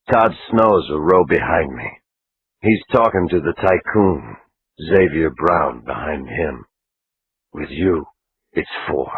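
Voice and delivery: low and level, like a cop laying out a plan; hushed male voice planning in church; Conlon's voice. Acted. Narrator voices Conlon, lower.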